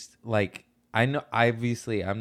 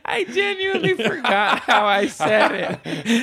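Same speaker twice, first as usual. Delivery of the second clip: high, laughing confession; 10 semitones higher, 3 dB louder than usual. Real, not acted. Guest admitting a botched word to host, laughing. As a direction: squeeze the words out through laughter.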